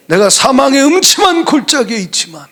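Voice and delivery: ordinary delivery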